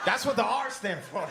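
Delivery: ordinary talk, nothing marked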